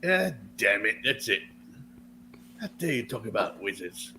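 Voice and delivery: ordinary talk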